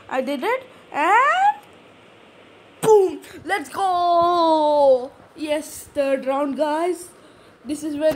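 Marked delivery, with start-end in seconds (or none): 0.9-5.1 excited cheer. Real, not acted. Gamer wins round.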